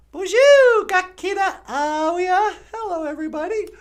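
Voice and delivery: very high, loud cartoon puppet greeting; high puppet bonjour. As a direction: squeak it out like a bubbly puppet.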